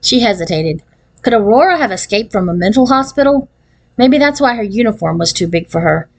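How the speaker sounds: plain reading voice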